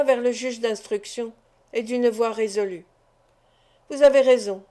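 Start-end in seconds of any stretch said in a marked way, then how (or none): 3.9-4.6 resolute. A narrator's reading. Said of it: "dit-elle rapidement".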